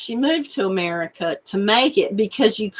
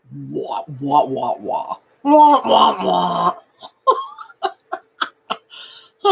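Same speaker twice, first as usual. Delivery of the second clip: hammed-up fake boohoo, building to a wail; playful fake sob over her crochet; mock crying; 5 semitones lower, slower than usual. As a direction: pretend to bawl like a baby. Can't crochet straight, fake crying.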